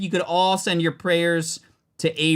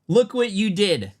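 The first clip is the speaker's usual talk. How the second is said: ordinary talk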